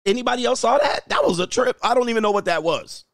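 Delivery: high, amazed disbelief; surprised. Real, not acted. Streamer asking chat about the pop-up, high amazed voice. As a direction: thrown by what just happened.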